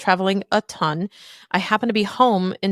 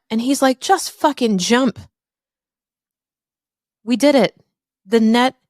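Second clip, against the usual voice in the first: louder and higher, building with fervour; rising passion; 4 semitones higher, 4 dB louder than usual. Real, not acted. Telling listeners how dad built her a safety net, building excitement. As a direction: drive it home like the big finish.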